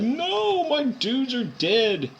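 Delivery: high, drawn-out mock wail; hammed-up cry of despair; freaking out. Gamer plays the doctor seeing casualties, high frantic wail. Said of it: "the doctor's freaking out right now. He's like".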